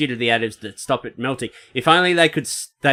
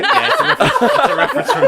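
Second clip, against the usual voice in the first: laughing; 6 dB louder, faster than usual. Real, not acted. Laughing reply about Jaws.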